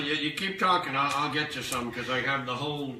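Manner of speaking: normal talking voice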